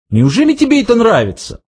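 high, quick incredulous question. A narrator's reading. Ford asking the guard about his job, incredulous. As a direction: ask in high-pitched disbelief, rushing it.

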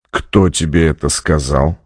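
low, steely question. A narrator's reading. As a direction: ask it low with a touch of metal. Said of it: "в его голосе впервые послышались металлические нотки". (низко, с металлическими нотками в голосе)

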